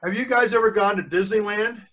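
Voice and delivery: plain reading voice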